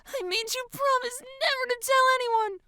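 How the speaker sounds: put-on high, whiny girlish reproach; tearful. A narrator's reading. Girl reminding friend of promise, tearful. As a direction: whimper it in a high voice. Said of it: "She wipes her eyes".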